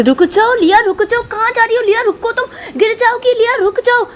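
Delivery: high frantic calling. Acted. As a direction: shout to stop the running girl. Running after daughter.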